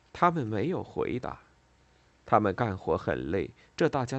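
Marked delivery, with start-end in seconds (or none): none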